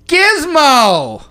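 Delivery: loud, high character yell; yelled name. Acted. Host playing Gizmo yelling his own name, loud. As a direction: belt out the name as the creature. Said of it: "Why is he yelling his name?".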